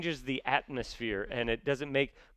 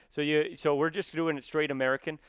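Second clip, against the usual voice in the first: usual speaking voice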